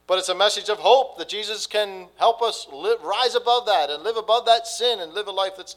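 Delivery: loud, high, fervent preaching; preaching at full force. Acted. Pastor urging the congregation toward hope, preaching full force. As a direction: lift the whole room with the good news.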